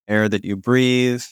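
ordinary talk, nothing marked

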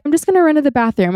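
high, quick, imitated near-tears voice; voice starting to break. Upset guest excusing herself to a worried friend, voice starting to break. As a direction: retell it high and hurried, as if about to crack. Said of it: "my voice started breaking".

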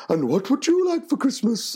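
high, hammed-up Santa question; lofty put-on Santa voice; Father Christmas voice. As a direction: ask a child like a jolly grotto Santa. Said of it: "And I went".

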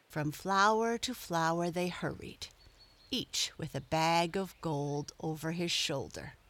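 ordinary delivery

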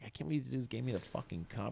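hushed, quick inner voice; retold private amazement, soft and hurried; awed disbelief. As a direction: say it fast under your breath, amazed. Tripping over first cover, retold.

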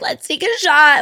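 high, loud party-girl imitation; mock drunk friend. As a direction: squeal it like a pushy drunk friend.

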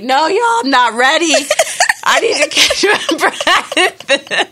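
high, loud protest breaking into laughter; laughing protest. Retold or imitated. Mother retelling refusing to pick up baby, laughing protest. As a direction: plead for a break while cracking up.

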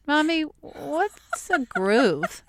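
put-on high, slow little-kid voice; high, drawn-out child's question; imitating young son. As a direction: play a puzzled child asking mommy. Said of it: "he came up and he said".

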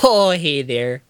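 slow, drawn-out Midwest greeting; Midwest accent. Acted. Greeting as a friendly local, Midwest accent. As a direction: stretch it out in a Minnesota accent. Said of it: "That is a very fun accent".

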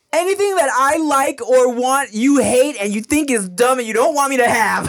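high-pitched, hammed-up childish outburst; exaggerated kid tantrum. Young child mad at mom, exaggerated high whine. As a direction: imitate a frustrated kid complaining. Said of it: "say the most exaggerated shit I could".

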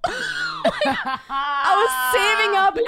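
high, slow words broken by laughter; laughing through words. Real, not acted. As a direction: laugh it out as you confess. Laughing while retelling.

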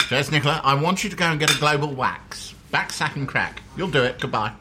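lightly nasal, brisk boss on voicemail; a hint of a nasal editor giving orders; nasal editor impression. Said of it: "She has this slightly nasal voice".

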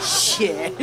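ordinary delivery

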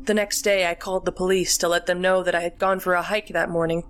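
ordinary delivery, nothing marked

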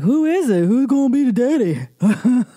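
high, hammed-up swooping voice; mock suspense. Viewer mocking herself over a cliffhanger, hammed-up suspense. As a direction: squeal it like an over-invested viewer.

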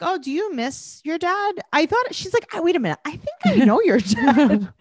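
put-on high, innocent child's voice; imitating a little girl. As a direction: wonder aloud like a curious four-year-old.

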